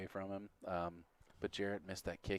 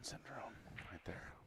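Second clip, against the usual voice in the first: whispering; 11 dB quieter, slower than usual. Real, not acted. Commentator talking during play.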